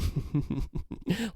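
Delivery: soft imitated villain giggle; maniacal build-up laugh. Creator demonstrating the villain, soft imitated maniacal giggle. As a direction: quietly mimic the building laugh. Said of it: "little maniacal build-up laughter".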